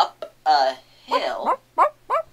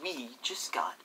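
whispering; 12 dB quieter, faster than usual. Real, not acted. Whispered before song.

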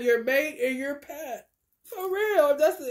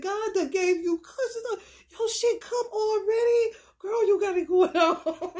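high voice breaking into laughter; laughing teasing; 9 semitones higher than usual. Acted. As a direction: tease the cat while cracking up. Teasing cat, cracking up.

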